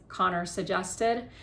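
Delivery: ordinary delivery, nothing marked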